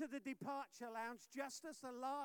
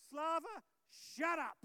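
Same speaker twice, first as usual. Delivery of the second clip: mock stern shut up; 7 semitones higher, 10 dB louder, slower than usual. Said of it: "You can see I'm a good pastor".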